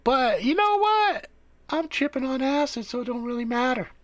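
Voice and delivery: hammed-up stoner squeak; mock stoned voice. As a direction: play the giddy guy high on acid. Stoned self answering Sam.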